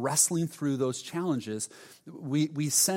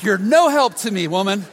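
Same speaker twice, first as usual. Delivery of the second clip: louder, higher mock indignation; hammed-up grumble at his wife; husband playfully scolding wife, loud; mock indignant; 6 semitones higher, 11 dB louder than usual.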